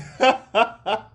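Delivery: sudden high loud laugh; a burst of laughter. Real, not acted. Laughs at her own mix-up.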